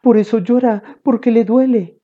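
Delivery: high-pitched mom explaining; mother's voice. A narrator's reading. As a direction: play a concerned mother, pitched well up. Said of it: "dijo mamá".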